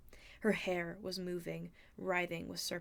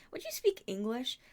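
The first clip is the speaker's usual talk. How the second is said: raised, impatient question; exasperated; 5 semitones higher, 4 dB louder than usual. A narrator's reading. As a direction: cut in on the technical talk. Percy exasperated by jargon.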